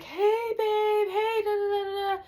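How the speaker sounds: very high sing-song babbling; playful greeting. Retold or imitated. Friend getting into the car to boyfriend, playful sing-song greeting. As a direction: imitate a gushing girlfriend, high-pitched.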